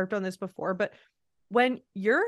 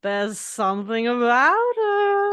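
slow, drawn-out tipsy impression of Lisa; mimicking the tipsy host, slow and louder; imitating Lisa; 3 semitones higher, 6 dB louder, slower than usual. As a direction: drag the words out like she's had a few. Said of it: "She goes".

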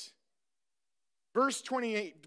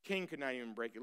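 much softer, lower echo of King could not even break it; hushed repeat; 9 semitones lower, 11 dB quieter, much faster than usual. Shown as a demonstration. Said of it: "repeats softly".

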